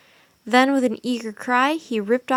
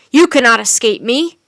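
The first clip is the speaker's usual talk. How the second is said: much louder menacing threat; shouted threat; 10 dB louder than usual. A narrator's reading. Wizard shouts after the escaping children. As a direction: make them freeze in place. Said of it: "shouted the wizard".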